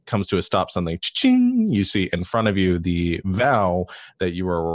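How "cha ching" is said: high, ringing imitated chime; cha-ching sound effect. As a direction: sing out a jackpot ding. Imitates the wheel landing.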